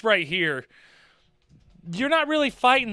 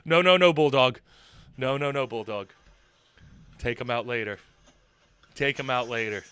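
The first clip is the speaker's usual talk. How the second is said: colleague holding back; 8 semitones lower than usual. Retold or imitated. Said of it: "backed her off like".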